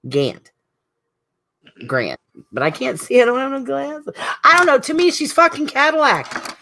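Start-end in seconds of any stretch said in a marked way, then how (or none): 2.5-6.6 high squeaky exclamation. Real, not acted. Joking about not knowing name.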